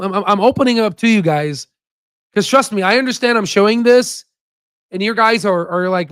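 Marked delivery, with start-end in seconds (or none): none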